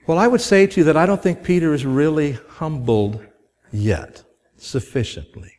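normal talking voice

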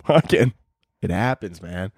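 normal talking voice

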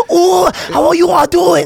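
high, loud mock Indian accent, half laughing. Mocking someone back from a trip, Indian accent. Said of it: "you go to India for, like, a week".

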